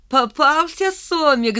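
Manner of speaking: протяжный крик высоким голосом; заори, поймав жертву; торжествующий ор. A narrator's reading. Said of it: "заорал он". (орёт высоко и протяжно)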